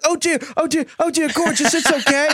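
very high, soothing mock chef voice; reacting to Trevor Lawrence's mistakes as the chef; gentle chef impression. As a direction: coo it like comforting a child. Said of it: "I'm like".

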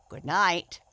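a friendly frog calling goodnight; cheerful frog, said a little loud; tree frog. A narrator's reading. Tree frog bids goodbye.

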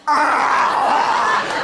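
a short laughing roar of rage; angry gargoyle roar. Retold or imitated. Angry at the date, a mock gargoyle roar. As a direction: snarl like a gargoyle.

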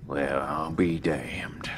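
slow, stunned whisper. Acted. Earl, stunned, whispers at the woman on the stairs. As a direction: breathe it out in slow shock.

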